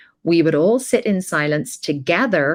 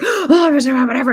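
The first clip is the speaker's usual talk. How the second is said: much higher, slow, drawn-out dismissive sigh of words; 11 semitones higher, 5 dB louder, slower than usual. Retold or imitated. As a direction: throw up your hands, stretched and high. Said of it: "And Lorraine was like".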